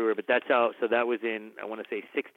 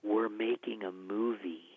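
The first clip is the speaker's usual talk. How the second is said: gentle rebuke; 5 dB quieter, slower than usual. Retold or imitated. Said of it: "He goes".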